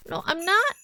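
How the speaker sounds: high, loud protest; desperate loud no at the ghost; shouted denial. Acted. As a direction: cry out against the accusation.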